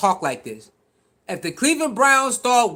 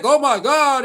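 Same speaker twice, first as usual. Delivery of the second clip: mock dismay; 5 semitones higher, 4 dB louder than usual. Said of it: "And the quarterback".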